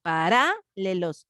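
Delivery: Paralelos with RA punched and held; wrong stress on RA. Shown as a demonstration. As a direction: test it as paRA-lelos, slowly. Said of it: "No, tampoco se escucha bien".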